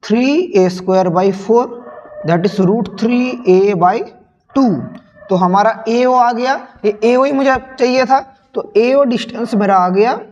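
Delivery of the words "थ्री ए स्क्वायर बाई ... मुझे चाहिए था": ordinary talk, nothing marked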